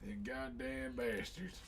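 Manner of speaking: put-on Texan southern twang, drawn out; southern accent. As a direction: grumble it in a mock southern accent. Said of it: "with that southern accent".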